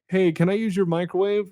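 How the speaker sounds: high, loud, oblivious office colleague; put-on cheery coworker voice; chirpy coworker. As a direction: pitch it way up like an eager coworker. Voicing an annoying office coworker.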